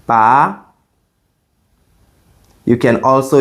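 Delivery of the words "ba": "Ba" rising like a question; questioning, climbing "Ba"; second tone. Shown as a demonstration. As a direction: slide "Ba" upward in pitch. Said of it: "The second tone rises".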